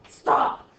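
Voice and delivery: loud, emphatic single shout; driving it home. Acted. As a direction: bark out a hard command. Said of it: "more like driving it home".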